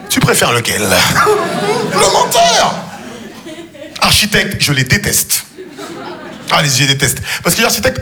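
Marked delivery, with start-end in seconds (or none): 0.8-2.7 very slow high drawn-out shout; mock outraged cry for the liar; emphatic shout. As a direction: belt out the answer. Answering own question emphatically.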